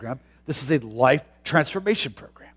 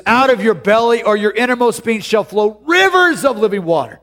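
preached scripture; 7 semitones higher, 5 dB louder than usual. Acted. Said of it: "One translation says".

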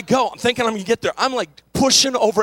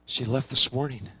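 grieving clerk; 9 semitones lower, 10 dB quieter than usual. Retold or imitated. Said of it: "And he said".

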